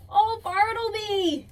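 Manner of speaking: loud, high, slow cry of dismay; exasperated exclamation. A narrator's reading. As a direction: wail his name in fond despair. Exclaiming at the dog's calamity.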